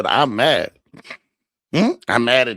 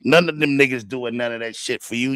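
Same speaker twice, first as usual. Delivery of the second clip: ordinary delivery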